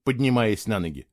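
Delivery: ordinary delivery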